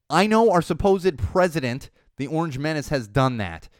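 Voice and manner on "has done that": ordinary talk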